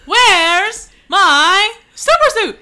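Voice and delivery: loud, slow, drawn-out demand; yelled movie quote. Retold or imitated. Husband yelling to his wife for his suit, loud and slow. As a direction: shout it up the stairs at the wife.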